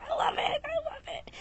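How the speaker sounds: very soft, airy whisper; whispered gushing. Real, not acted. Fan gushing aside about a beloved show, whispered.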